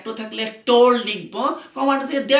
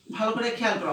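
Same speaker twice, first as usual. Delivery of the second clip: ordinary talk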